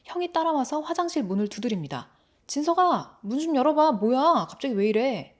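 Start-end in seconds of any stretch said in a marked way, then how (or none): 2.5-5.4 loud, high call through a door; urgent calling out. Acted. Older brother knocking on the bathroom door, calling out loudly. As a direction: call out, demanding to be let in.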